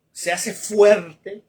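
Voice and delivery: "fuerte" loud, slow and drawn out; slow, booming stress on "fuerte"; emphatic proclamation. Acted. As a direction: proclaim "se hace fuerte" slowly and loudly. Preacher proclaims strength.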